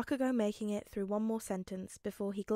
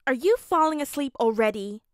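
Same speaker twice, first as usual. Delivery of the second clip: loud; 3 semitones higher, 9 dB louder than usual. Acted. Loud question.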